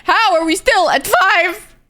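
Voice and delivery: high, slow wail of disbelief; drawn-out disbelieving whine; outraged disbelief. Real, not acted. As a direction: stretch it out in high-pitched outrage. Outraged at game stat.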